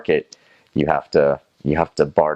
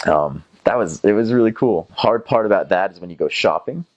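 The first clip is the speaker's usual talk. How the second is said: ordinary delivery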